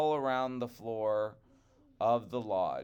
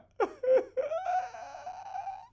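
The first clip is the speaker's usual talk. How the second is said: high giggle, close to tears; real giggling laugh; 3 dB louder than usual. Real, not acted. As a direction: laugh helplessly. Reader laughs again.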